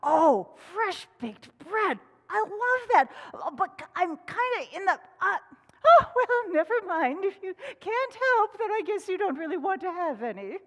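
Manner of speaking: squirrel voice. Acted. Squirrel dodging work, high and loud. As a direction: gush then make excuses. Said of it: "Squirrel said".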